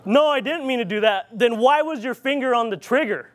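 ordinary talk, nothing marked

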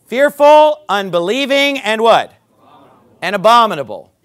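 loud, slow, hammering pulpit delivery; full-force preaching. Acted. Preacher pounding the verse to his congregation, loud and slow. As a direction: drive each word home to the pews.

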